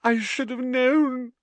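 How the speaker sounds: high, drawn-out wail; tearful, melodramatic lament; tragic. A narrator's reading. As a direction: wail it with theatrical grief. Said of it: "said Lavender tragically".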